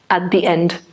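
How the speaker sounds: normal talking voice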